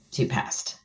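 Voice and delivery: a stunned whisper, breathless. Real, not acted. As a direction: barely let the words out. Repeats the moment of death, whispered.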